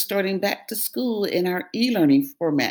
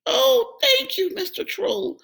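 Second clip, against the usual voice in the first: very high relieved goat voice; a grateful little goat; high squeaky thankful goat; middle goat voice. A narrator's reading. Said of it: "said Middle Billy Goat Gruff".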